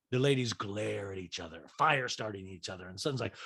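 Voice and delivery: ordinary talk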